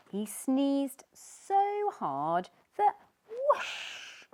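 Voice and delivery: slow, loud build to whoosh; dramatic whoosh. A narrator's reading. Narrator telling of the huge sneeze, slow and loud. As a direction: stretch it out big.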